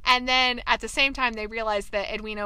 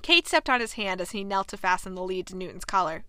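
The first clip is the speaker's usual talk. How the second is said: ordinary delivery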